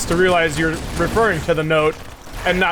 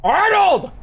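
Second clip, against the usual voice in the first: high shout of Arnold; calling out; 13 semitones higher, 3 dB louder than usual. Pretend. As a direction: yell the name Arnold.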